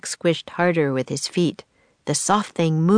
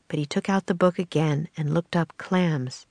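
plain reading voice

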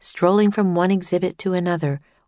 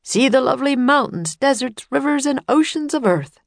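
tour guide announcer; 8 semitones higher than usual. Acted. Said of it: "said the tour guide".

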